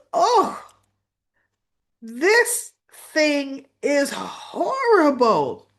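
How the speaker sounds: high, loud, drawn-out mock revulsion; hammed-up disgust. [name] spitting out the cupcake before everyone, theatrically disgusted. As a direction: stretch it slowly in exaggerated disgust.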